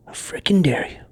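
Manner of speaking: mock-scandalised hushed scolding; indignant whisper. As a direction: hiss the outrage quietly. Whispered outrage continues.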